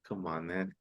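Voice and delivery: ordinary talk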